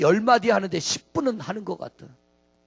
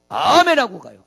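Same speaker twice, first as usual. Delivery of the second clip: much louder answer on 아멘하고; emphatic answer; 10 dB louder than usual. Shown as a demonstration. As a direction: declare 아멘하고 가요 with force. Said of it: "아멘하고 갑니까?". (아멘하고를 힘있게 크게)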